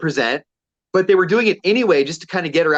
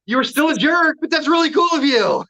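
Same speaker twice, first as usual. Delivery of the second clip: much higher, called-out mock praise; retold parting jab; 10 semitones higher than usual. Customer calling after rude woman walking out, mock-appreciative. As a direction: call it after someone walking out.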